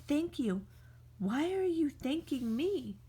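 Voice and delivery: plain reading voice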